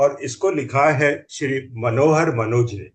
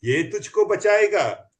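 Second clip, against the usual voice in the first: mocking challenge; 5 semitones higher than usual. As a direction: taunt him, then build to the boast. Thief taunts narrator in poem. (चोर का चुनौती भरा ताना, आख़िर में तेज़ और ऊँचा)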